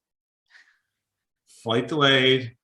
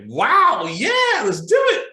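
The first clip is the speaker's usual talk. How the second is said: much higher, louder excited whoop; excited agent; 13 semitones higher, 6 dB louder, slower than usual. Retold or imitated. Airline agent catching the caller's energy, loud excited whoop. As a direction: cheer back, squealing with delight. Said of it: "And she's like".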